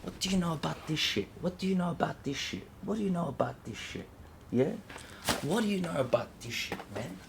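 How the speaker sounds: tense murmur. Real, not acted. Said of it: "I'm fucking angry".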